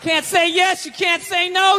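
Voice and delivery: normal talking voice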